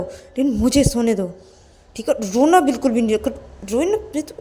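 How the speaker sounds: high, forceful warning; angry Payal, threatening. Acted. Payal forbids Ananya to leave the room, angry threatening.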